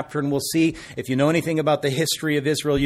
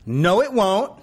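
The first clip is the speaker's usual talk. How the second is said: higher, louder stubborn retort; defiant child voice; 7 semitones higher, 3 dB louder, slower than usual. Retold or imitated. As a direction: talk back like the stubborn toddler. People defying God.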